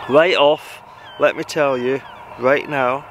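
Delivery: usual speaking voice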